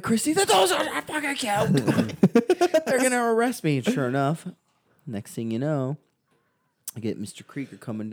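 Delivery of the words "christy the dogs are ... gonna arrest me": high, frantic mock squeal; acted panic. Teen fearing arrest corrects his classmate, hammed-up panicked squeal.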